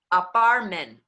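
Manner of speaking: Apartment ohne T, spanisch gefärbt, lauter; dropped Ts. Shown as a demonstration. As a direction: drop the T's in Apartment. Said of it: "All I did was drop the T's".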